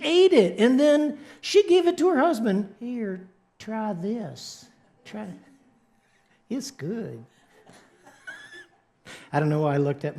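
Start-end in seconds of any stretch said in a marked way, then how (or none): 2.8-7.2 very soft, slow temptation; soft coaxing. Acted. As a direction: offer it in a hushed, enticing voice. Eve tempting her husband with fruit.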